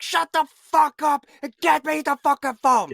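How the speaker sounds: imitating a furious high-pitched shout; freaking-out yell. Officer snapping back at his superior, hammed-up angry yell. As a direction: blow up at them, loud and high. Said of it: "freaking out".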